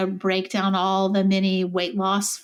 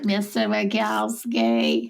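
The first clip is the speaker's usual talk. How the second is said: slow, stretched-out cartoon character voice; teasing, dragged-out impression; monster impression; much slower than usual. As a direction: draw out the name like a cartoon monster.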